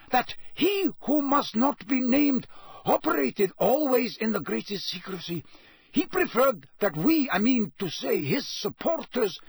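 high, hasty pleading; hurried, nervous. A narrator's reading. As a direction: rush to explain. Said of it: "said Karkaroff hurriedly".

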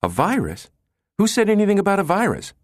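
high, rapid startled question; startled alarm. A narrator's reading. Assistant jumps at the word virus, startled and alarmed. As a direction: jump on it, pitch shooting up as you go. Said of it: "Brinkerhoff jumped".

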